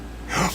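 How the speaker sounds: quick startled intake of breath; sharp gasp. Real, not acted. Streamer finding the answer, sharp gasp. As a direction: gasp at the discovery.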